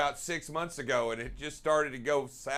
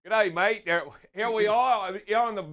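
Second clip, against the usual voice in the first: a higher, louder jokey Aussie greeting; mock Australian accent; 6 semitones higher, 4 dB louder than usual. Co-host answering the host in a louder mock Australian accent. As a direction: throw back a cheeky Australian g'day. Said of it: "You can't use the same accent as I have".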